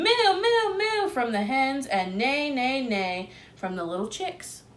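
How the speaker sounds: usual speaking voice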